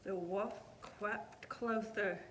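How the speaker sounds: usual speaking voice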